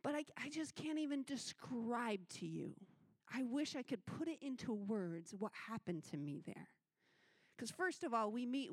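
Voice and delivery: ordinary talk, nothing marked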